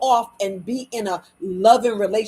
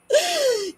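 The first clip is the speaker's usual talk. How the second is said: sharp, high acted gasp; shocked gasp. Listener hit by words the heart needs, shocked gasp. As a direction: gasp as if struck by it.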